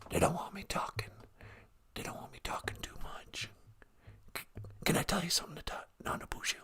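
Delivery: furtive whisper down the phone; Joe Biden. Acted. President afraid of handlers hearing, whispered. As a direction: whisper it like a secret. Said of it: "you're whispering?".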